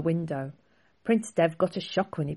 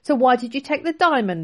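loud, higher demanding question; 8 semitones higher, 7 dB louder than usual. Acted. Prince interrogating the thief, demanding. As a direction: press him hard for the answer. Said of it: "the prince demanded".

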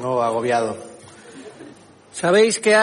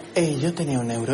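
quiet dismay; 6 semitones lower, 6 dB quieter than usual. Retold or imitated. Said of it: "yo digo".

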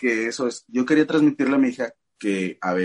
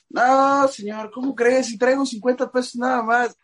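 imitating a Mexico City chilango accent, pitched higher; hammed-up chilango accent, haggling with a taxi driver; acento chilango; 10 semitones higher than usual. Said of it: "Hasta nos salió el acento chilango". (acento chilango exagerado, más agudo)

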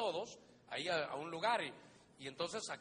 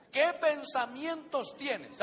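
emphatic preaching question; 6 semitones higher, 8 dB louder, much slower than usual. Acted. Preacher pressing the congregation.

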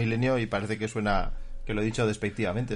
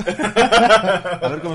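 laughing; 7 semitones higher, 12 dB louder, much slower than usual. Real, not acted. Laughing over drinking joke.

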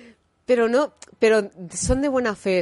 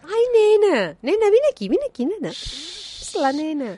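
put-on Catalan lady accent; 11 semitones higher than usual. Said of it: "hay señoras catalanas que son así".

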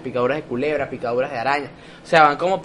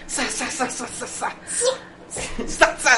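ninja swoosh sounds; 8 semitones higher, 6 dB quieter than usual. Pretend. Mimicking ninja sound effects.

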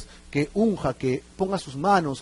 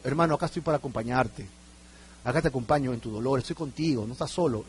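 low, soft words of comfort; gentle consoling; 6 semitones lower, 7 dB quieter than usual. Acted. Church brother keeping a mourner company, gently consoling. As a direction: lower the voice and reassure tenderly.

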